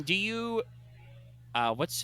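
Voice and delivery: ordinary talk, nothing marked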